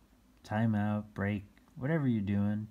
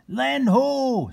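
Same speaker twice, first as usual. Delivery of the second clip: loud, much higher sailor's cry; yelled; 10 dB louder than usual. A narrator's reading. Nick yells from the mast, spotting land. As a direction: bellow it from the mast. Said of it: "When Nick yelled".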